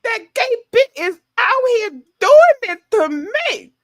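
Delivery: high, loud put-on Dubby voice; shrieking Dubby impression; Dubby impression, shouted. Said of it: "I'm the Dubby".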